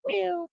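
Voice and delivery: high, soft meow at the cat; imitated meow. Real, not acted. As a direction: mew back to the kitty. Meowing at his cat.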